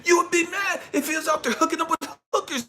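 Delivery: dad yelling at his daughter about sex; furious yelling. Retold or imitated. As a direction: shout her down.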